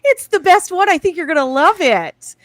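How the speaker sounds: high, loud gushing mother; doting mom voice. Pretend. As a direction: gush like a proud mom. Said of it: "I expected the mom to be like".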